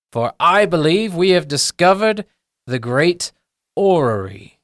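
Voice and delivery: excitable academic proclaiming to recruits; grandiose gnome professor. Acted. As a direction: announce a great discovery, pausing for drama. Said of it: "He goes".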